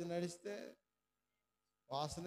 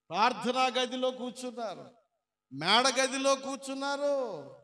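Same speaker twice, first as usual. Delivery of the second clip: preaching at full force; 6 semitones higher, 8 dB louder than usual. Real, not acted. Preacher proclaiming the answer to the congregation, loud. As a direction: drive the answer home.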